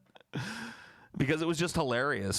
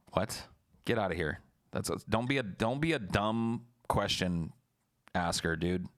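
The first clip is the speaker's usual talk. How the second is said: normal talking voice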